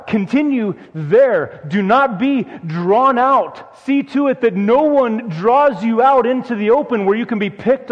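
urgent pulpit exhortation, building in force; rising preaching. Acted. Pastor urging the congregation to stay safe in Christ, insistent preaching. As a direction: plead with them to stay put, word by word.

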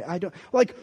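ordinary delivery

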